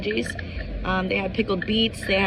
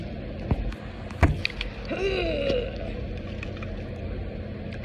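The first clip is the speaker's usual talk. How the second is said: dismayed sigh; 9 semitones higher, 5 dB quieter than usual. Real, not acted. Just spilt vinegar on hoodie.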